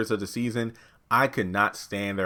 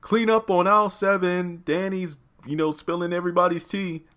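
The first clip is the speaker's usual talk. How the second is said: hammed-up PA announcement, pitched up; mock intercom announcement; 8 semitones higher than usual. Reviewer mocking a castmate with a store intercom call, as a pitched-up announcer. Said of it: "a call on the intercom".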